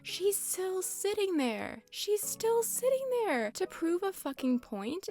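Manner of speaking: high, slow, squeaky exasperated whine; squeaky mock outrage. Ranting at the villager who won't move, in a high squeaky whine. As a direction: stretch it out in a squeaky indignant voice.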